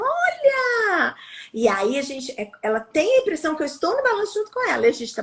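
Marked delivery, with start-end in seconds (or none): 0.0-1.1 high, bright exclaim; playful high cry of look; excited call. Retold or imitated. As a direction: sing it out to the child on the swing. Cheering a child on swing.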